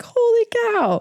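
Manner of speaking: high-pitched, astonished exclamation; frantic amazement. Retold or imitated. Acting out Arjuna not coming from a calm place, frantic astonishment. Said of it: "coming from a frantic".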